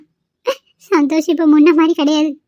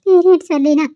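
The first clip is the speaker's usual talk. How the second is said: crying. Acted. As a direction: speak while weeping. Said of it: "அழுகாத ரெஜி".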